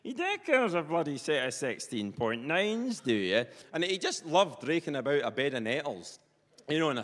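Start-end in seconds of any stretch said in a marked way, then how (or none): 0.0-3.4 broad Perthshire farmer, drawn-out and higher; broad rural Perthshire patter; Perthshire accent. Retold or imitated. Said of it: "in his Perthshire accent".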